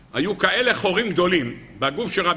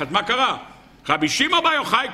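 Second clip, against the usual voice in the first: ordinary talk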